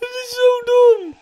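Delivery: very high, slow loud whine; rattled wail. Real, not acted. Player startled twice calls it dumb, rattled wail. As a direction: squeal it out in exasperation.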